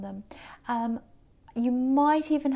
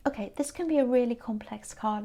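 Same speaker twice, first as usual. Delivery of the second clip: ordinary talk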